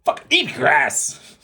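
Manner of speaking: put-on a tiny old lady's slow, blunt brush-off; curt old lady. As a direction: spit it out slowly like a fed-up elder. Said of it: "The little Japanese lady looked at him".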